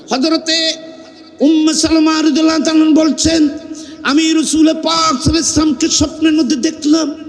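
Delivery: high, sustained sermon chant; melodic preaching. Acted. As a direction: intone it like a preacher telling sacred history. Preacher intones the narration.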